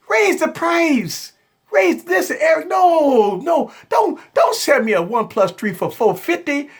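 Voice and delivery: high, whiny mock customer begging; mock pleading fan. Fans begging Eric to raise the price, high mock whine. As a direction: ham up a fan pleading with a phone maker. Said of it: "They say".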